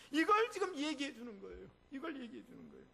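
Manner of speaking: high, loud sermon delivery; fervent preaching. Acted. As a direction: pastor voicing Jesus to the sea, preaching with force. Preaching at full force.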